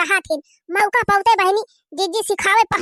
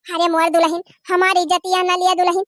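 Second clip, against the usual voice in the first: ordinary delivery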